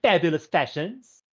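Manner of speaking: high, theatrical fashion diva; gushing fashion boutique owner. Acted. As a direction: gush grandly, stretching the key words. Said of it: "walks up to you and goes".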